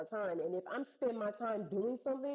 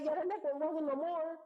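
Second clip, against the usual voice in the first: normal talking voice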